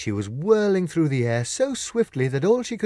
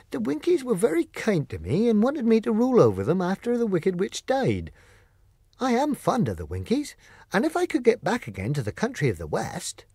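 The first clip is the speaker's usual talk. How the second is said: higher, gentle tin man voice, fond and wistful; Tin Woodman voice; 8 semitones higher than usual. Acted. Tin Woodman tells Glinda his wish, higher gentle voice. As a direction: play the kindly Tin Woodman longing for his Winkies.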